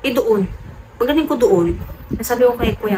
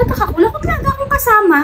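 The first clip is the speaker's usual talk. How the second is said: surprised; faster than usual. Retold or imitated. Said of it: "Sabi ko".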